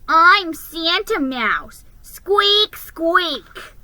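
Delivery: high, loud, slow squeaky mimicry; mocking taunt. A narrator's reading. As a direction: make fun of the mouse. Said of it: "he yelled".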